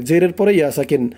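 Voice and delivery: usual speaking voice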